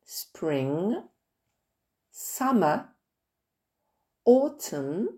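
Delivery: Spring, summer, autumn in careful English; English pronunciation. Shown as a demonstration. Teacher modelling English seasons for French pupils, careful English. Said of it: "Et les saisons".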